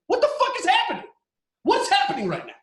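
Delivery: fired-up shout. Real, not acted. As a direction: blow up in disbelief. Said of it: "I'm sorry, I'm fired up".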